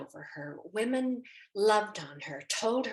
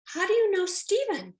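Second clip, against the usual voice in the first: sharp, much higher and louder challenge; high demanding question; 12 semitones higher, 8 dB louder than usual. Retold or imitated. To the stranger hugging Stephen, a high sharp demand. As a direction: demand it high and loud, suspicious.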